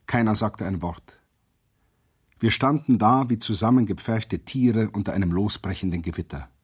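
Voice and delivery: ordinary delivery, nothing marked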